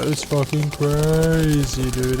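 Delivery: slow, stretched-out words; drawn-out hype. Real, not acted. Player marvels at the pickaxe, drawn-out hype. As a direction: drag out the word crazy.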